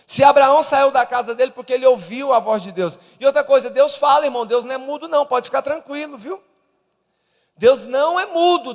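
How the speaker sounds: loud, rising preacher's cry; fiery pastor preaching loud; preaching at full force. Acted. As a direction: thunder it out to the congregation.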